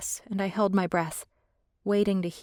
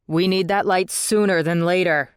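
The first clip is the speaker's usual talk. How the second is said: yelled; 6 dB louder than usual. A narrator's reading. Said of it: "Liam yelled".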